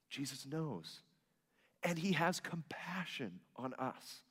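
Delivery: unvoiced hushed whisper. Real, not acted. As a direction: lean in and whisper it. Softens to a whisper.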